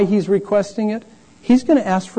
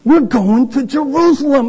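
higher, put-upon disciple voice; higher mock disciple voice; self-important disciple; 7 semitones higher than usual. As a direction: sound like a follower in a hurry. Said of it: "they're like".

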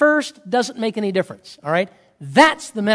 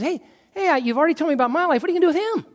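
worried busybody, pitched high; hurried, high-pitched questioning; Peter; 7 semitones higher, much faster than usual. Acted. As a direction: press nervously for an answer about someone else. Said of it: "we see Peter, as he always is".

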